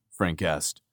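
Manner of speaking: plain reading voice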